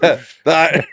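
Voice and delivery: usual speaking voice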